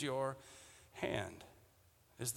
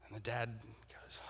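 hushed whisper building suspense; 8 semitones lower, 18 dB quieter than usual. Real, not acted. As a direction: drop to a whisper. Dramatic pause before the answer.

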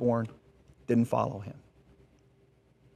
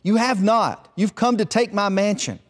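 higher, insistent objection; indignant retort; 7 semitones higher than usual. Acted. Hut brother accusing the eldest of taking his mansion, indignant. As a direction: argue back defensively, acted.

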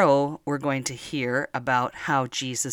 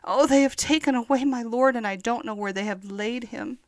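higher, pleading voice of a weeping woman; grieving Mary; 9 semitones higher than usual. Acted. Mary Magdalene telling the angels her Lord is gone, higher pleading. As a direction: plead like someone who lost their dearest friend. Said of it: "And she said".

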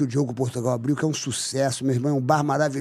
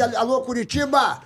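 high, drawn-out greeting to a city; announcer call-out; 7 semitones higher, slower than usual. Real, not acted. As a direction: call out to a whole crowd, stretched. Calls out to a city's audience.

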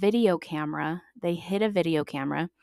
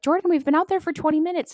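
high, fast teasing sibling voice; siblings' mock scolding; 10 semitones higher, 5 dB louder, faster than usual. Siblings taunting forgotten Jordan, high fast mockery. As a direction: mimic the siblings, high and quick. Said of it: "they were like".

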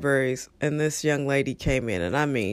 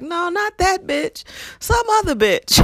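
coworker's blurt; 13 semitones higher than usual. Retold or imitated. Coworker retold blurting about the rude student. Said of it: "He was like".

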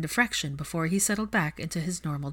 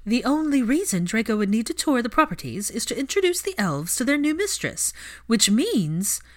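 higher, louder pointed explanation; Narcissa's voice; 6 semitones higher, 5 dB louder than usual. A narrator's reading. Narcissa tells Hermione what the tour means, higher and pointed. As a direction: spell it out with rising insistence.

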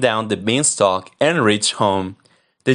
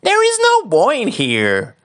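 much higher, thin wife voice; giant's wife; 3 dB louder than usual. Acted. Wife hides Jack from the giant, high and innocent. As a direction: squeak it out innocently. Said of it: "The wife said".

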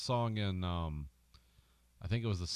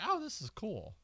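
much higher, airy mock delight; mock impressed; 12 semitones higher than usual. Italian fans hearing the gibberish song, mock impressed and high. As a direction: play the impressed listener. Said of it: "And it's like".

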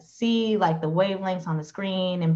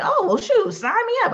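high, eager and thrilled; excited; 5 dB louder than usual. Retold or imitated. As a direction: jump at the offer, squealing high. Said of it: "I was like".

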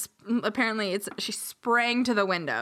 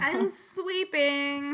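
higher, drawn-out singsong answer; daughter's calling voice; 5 semitones higher, much slower than usual. Acted. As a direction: stretch the word out, lightly ghostly. Voices the ghostly daughter, corrected line.

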